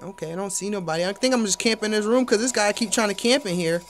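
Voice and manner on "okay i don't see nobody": ordinary delivery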